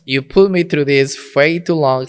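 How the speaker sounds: imitated indignant telling-off; mock-stern mother. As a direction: lay down the law as an exasperated mom. Voices mother refusing his return.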